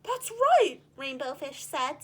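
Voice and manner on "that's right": much higher, louder surprised; startled happy answer. Acted. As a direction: eagerly confirm in surprise.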